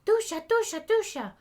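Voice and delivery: high, loud repeated shout of dusha; shouted word. A narrator's reading. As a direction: shout "dusha" three times, high and loud, hitting the head. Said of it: "as she repeatedly shouted".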